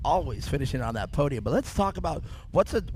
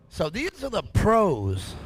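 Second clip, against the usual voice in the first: "pros" drawn out long with a falling tune; stress on pros; 5 semitones higher, 3 dB louder, much slower than usual. Real, not acted. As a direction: slowly hype up the word "pros". Hyping the pro class.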